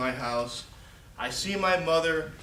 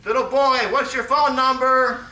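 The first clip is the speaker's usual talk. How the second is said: high, loud call down to the street; shouting from window; 11 semitones higher, 12 dB louder than usual. Retold or imitated. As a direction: yell it across from the window to the boy. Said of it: "Second floor windows".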